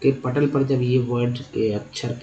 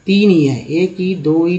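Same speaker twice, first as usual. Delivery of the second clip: ordinary delivery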